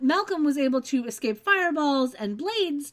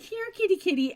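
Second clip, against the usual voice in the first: calling a cat; 6 semitones higher than usual. Retold or imitated.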